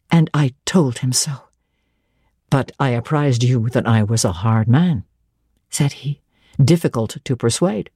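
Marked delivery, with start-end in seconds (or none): 2.5-7.9 lower, dry teasing clergyman's voice; St. John Rivers, low. A narrator's reading. Stern clergyman teasing Jane, in a lower voice. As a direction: play a reserved man gently mocking, lower.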